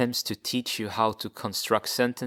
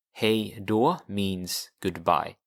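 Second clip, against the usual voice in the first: ordinary talk, nothing marked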